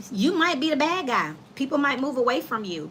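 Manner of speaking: normal talking voice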